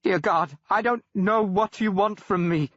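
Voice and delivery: bravado breaking into fear; frightened cry. Acted. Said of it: "he cried".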